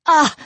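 short, sharp whiplash cry; cry of pain. A narrator's reading. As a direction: cry out as the whip lands. Whip strikes the woman speaking.

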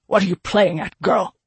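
loud, spat question. A narrator's reading. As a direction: spit the words out angrily. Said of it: "He spat the question".

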